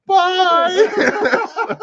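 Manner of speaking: high squeaky bye; laughing, high mock farewell; laughing mock goodbye.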